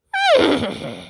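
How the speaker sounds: high whinny falling away; horse whinny. A narrator's reading. Horse delighted to be called Four Feet, whinnying. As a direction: imitate a horse's neigh. Horse neighs before the ride.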